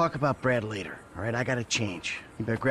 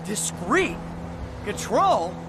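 sarcastic disbelief; 9 semitones higher than usual. Acted. Mocking the agent's words.

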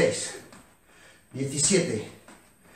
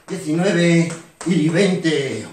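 drawn-out final count; 4 dB louder, much slower than usual. Shown as a demonstration. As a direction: stretch the last numbers out slowly, louder. Coach drags out final count.

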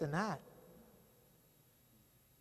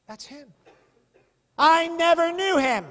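panicked denial; 10 semitones higher, 14 dB louder than usual. Retold or imitated. Peter cornered a second time, loud high denial. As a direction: bark the denial.

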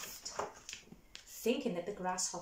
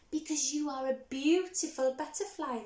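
louder, higher chorus cry; cried out; 5 semitones higher, 6 dB louder than usual. Acted. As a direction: cry out in praise. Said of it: "they all cried".

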